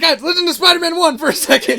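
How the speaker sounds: rally the confused group; calling for attention. Retold or imitated. Retold character calling group to attention.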